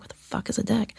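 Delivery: soft, puzzled aside under the breath; muttered confusion. Retold or imitated. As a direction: mutter it to yourself, baffled. Retelling her own confusion.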